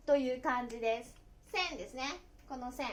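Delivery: ordinary talk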